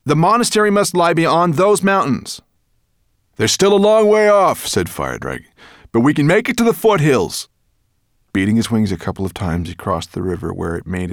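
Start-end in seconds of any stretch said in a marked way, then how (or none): 0.0-2.4 high, loud and eager; raised boy's voice, full of certainty; excited cry. A narrator's reading. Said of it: "cried Ben". 3.4-4.7 high, loud call in flight; raised voice carrying over distance. A narrator's reading. Dragon calls out in mid-flight. 5.9-7.5 high, loud shout; raised voice over the wind; calling out. A narrator's reading. Dragon calls out in mid-flight.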